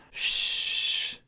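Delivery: long soft "shh"; imitated hushing. Classmate secretly shushing him in class, hushed. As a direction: hush them with a drawn-out shh. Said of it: "the amount of whispering that's going on".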